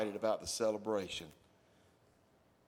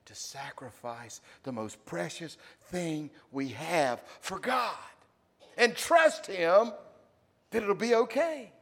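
slow, then higher and louder; building appeal; 7 semitones higher, 8 dB louder, slower than usual. Real, not acted. Pastor pleading with the church, building up. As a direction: rise to a passionate plea.

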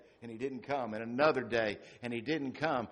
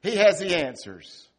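mock expectant; 5 semitones higher, 9 dB louder than usual. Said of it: "you think".

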